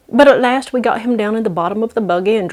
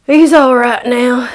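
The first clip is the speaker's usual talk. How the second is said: weary Bill voice; 4 semitones higher, 6 dB louder, slower than usual. Acted. Bill reassuring, bruised.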